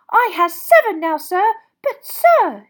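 excited, high, loud elf squeak; Dobby. A narrator's reading. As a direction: squeak it in wide-eyed delight. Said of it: "Dobby was utterly delighted".

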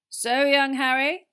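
slow, high, loud pompous prefect; Percy impression. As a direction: mimic a stuffy older brother. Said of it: "an uncanny impersonation of Percy".

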